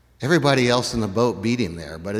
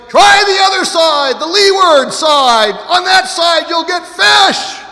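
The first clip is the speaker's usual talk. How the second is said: high, loud call across the water; 11 dB louder than usual. Acted. Stranger on the shore calling out to the fishermen. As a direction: shout it to the boat through cupped hands. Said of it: "cupping his hands and going".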